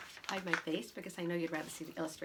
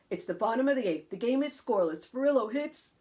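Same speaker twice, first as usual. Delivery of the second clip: loud, suspenseful game recap; uncle storytelling; 4 semitones higher, 8 dB louder than usual. Acted. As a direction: build up the big play. Uncle resumes baseball tale.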